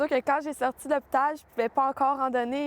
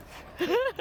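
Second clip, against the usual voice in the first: short laugh; 10 semitones higher than usual. Real, not acted. Laughing about her age.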